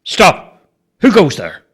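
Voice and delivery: firm, forceful sentry's command; authoritative challenge. A narrator's reading. Bobo showing the boy how to challenge, authoritative. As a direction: project force behind each word. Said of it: "put some authority in your voice".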